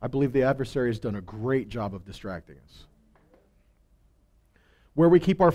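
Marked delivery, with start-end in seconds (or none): none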